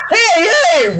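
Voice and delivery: high-pitched mock hail; eager calling out. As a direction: call out as if running up to someone. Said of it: "running in like".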